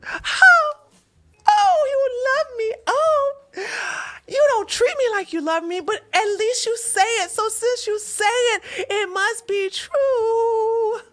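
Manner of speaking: high, swooning fake-crying voice; mock her swooning over empty words; mocking naive girlfriend.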